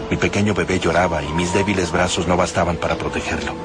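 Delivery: usual speaking voice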